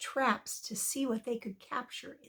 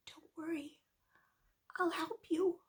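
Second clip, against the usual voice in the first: very soft, high, half-whispered; reassuring little mouse; 4 semitones higher, 5 dB quieter than usual. Acted. Little mouse to the trapped lion, softly reassuring. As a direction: hush it like a tiny helper.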